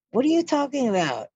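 high, incredulous outburst; shocked and insulted, pitch jumps way up; what are you talking about, outraged; indignant disbelief. Retold or imitated. Insulted reply to ex-husband.

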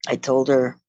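ordinary talk, nothing marked

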